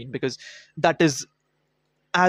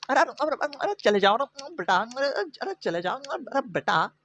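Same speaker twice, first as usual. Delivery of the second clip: higher, softer shooing cop impression; mock cop; 8 semitones higher, 4 dB quieter, much faster than usual. Cop sending Jaya away, higher and softer. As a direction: shoo her off with soft arre arre. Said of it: "then he's like".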